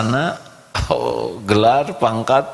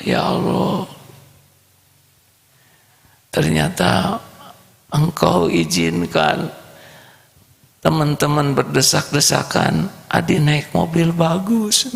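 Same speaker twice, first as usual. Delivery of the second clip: put-on child's prayer; 9 semitones higher than usual. Poor boy thanks God for the nice car, high child voice. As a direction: pray like a moved little boy.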